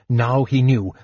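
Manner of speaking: usual speaking voice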